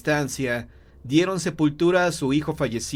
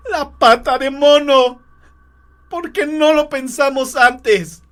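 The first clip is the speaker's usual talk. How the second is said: high, drawn-out desperate yell; frantic shout; 12 semitones higher, 9 dB louder, slower than usual. Acted. Grieving wife confronts her husband, shouting. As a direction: shout with sudden wild hope. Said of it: "Ella lo encaró y le gritó".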